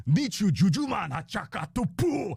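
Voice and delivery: high, loud alien gibberish yell in Huttese; screaming in Huttese. Acted. Extra yelling his Huttese line at the gambling fight, high loud alien scream. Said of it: "too boring for me to scream in English. I'm gonna learn Huttese".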